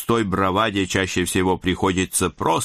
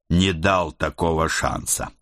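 plain reading voice

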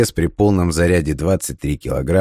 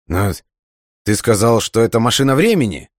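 higher-pitched, put-out objection; mild indignant protest; 5 semitones higher than usual. A narrator's reading. Man challenging the agent's time machine claim, mildly indignant. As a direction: object as if somewhat cheated, pitch climbing.

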